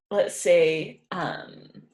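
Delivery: ordinary talk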